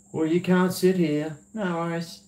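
tag. Acted. Acting out a turned-away diner exchange.

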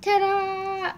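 bright high ta-da; excited reveal. Real, not acted. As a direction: announce it with a flourish. Reveal of horse.

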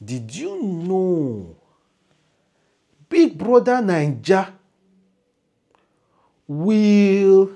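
high, loud, slow divine voice; a heavenly voice posing a grand question; voice from above. Acted. The angel's voice questioning the speaker, high and drawn out. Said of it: "he said to me".

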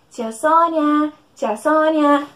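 slow, loud sing-song call. Acted. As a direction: call her name across the room. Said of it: "chamar a tia Sônia comigo".

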